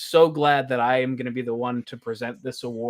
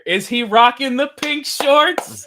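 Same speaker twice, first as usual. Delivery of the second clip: loud, high, drawn-out rising question; big playful announcement, voice climbing to the end; hyped reveal; 9 semitones higher, 6 dB louder, slower than usual. Real, not acted. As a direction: whoop it up like a hype man, stretching the words. Hyped-up reveal of award winner.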